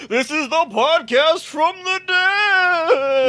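high, slow spooky ghost voice; spooky drawn-out words. Pretend. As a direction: stretch it out like a haunted house host. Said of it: "Everything is spooky when you draw out the last word".

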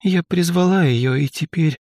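put-on high, tearful sobbing girl; crying out the words; through tears. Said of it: "сквозь поток слёз проговорила она". (сквозь поток слёз)

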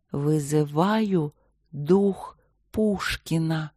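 slow, hollow voice from beyond the grave; sepulchral voice. A narrator's reading. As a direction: summon the spirit, slow and eerie. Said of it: "загробным голосом произнесла она".

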